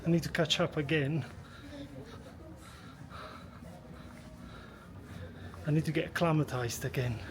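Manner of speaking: short of breath, hurried; winded from the altitude; gasping between phrases. Real, not acted. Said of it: "I'm out of breath".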